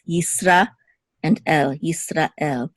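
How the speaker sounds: Yisrael broken into Yisra and El; two separate words. Shown as a demonstration. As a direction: set El apart at the end of Yisrael. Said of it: "as if it's two separate words".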